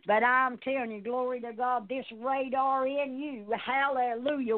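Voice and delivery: ordinary talk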